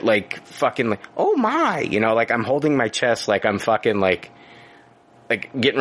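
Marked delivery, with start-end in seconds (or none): 1.2-1.8 put-on high, breathy-free startled old lady "oh my"; old woman gasp. Retelling the near crash, a high old-lady gasp. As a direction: clutch your chest like a startled old woman. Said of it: "I'm like an old woman".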